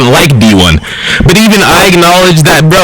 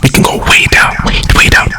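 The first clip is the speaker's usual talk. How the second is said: taunting whisper. Acted. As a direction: hush it down to a whisper.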